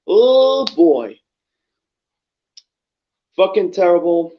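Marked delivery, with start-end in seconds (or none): 0.1-1.2 exasperated groan. Real, not acted. Reacting to bad wrestling match.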